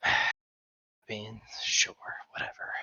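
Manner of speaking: soft, indifferent whisper; sighing, disinterested murmur; bored whisper. Acted. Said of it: "sits in their corner like".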